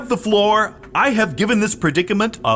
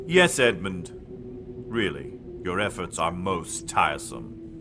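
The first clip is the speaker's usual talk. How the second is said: much lower, softer sinister lord; Dark Lord voice; 7 dB quieter than usual. Acted. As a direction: speak as the dark master, low and cold.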